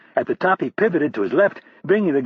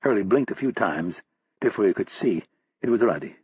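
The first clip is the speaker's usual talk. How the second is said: ordinary delivery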